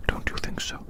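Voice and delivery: ordinary delivery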